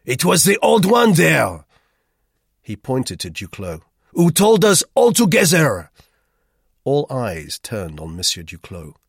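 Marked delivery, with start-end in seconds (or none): 0.0-3.8 loud, high accusation; irritated, pointing out the culprit at full voice; irritable. A narrator's reading. Roux blames Duclos, irritably. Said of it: "he demanded irritably". 4.1-5.9 loud, high accusation; irritated, pointing out the culprit at full voice; irritable. A narrator's reading. Roux blames Duclos, irritably. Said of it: "he demanded irritably".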